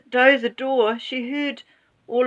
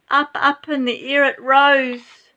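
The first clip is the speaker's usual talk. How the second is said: ordinary delivery, nothing marked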